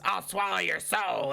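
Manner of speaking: slow, lightly voiced witch; a hint of a menacing witch. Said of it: "when the witch goes".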